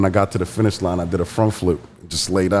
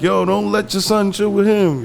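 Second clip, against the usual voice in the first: high imitation of a wary parent; mock worried parent; 11 semitones higher than usual. Mimicking neighborhood parents keeping kids away. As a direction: play the parent warning others off. Parents warning about a wild kid.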